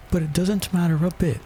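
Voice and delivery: put-on high-pitched girl talking to herself; Alice. A narrator's reading. Alice talking to herself in court, high musing voice. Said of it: "she thought to herself".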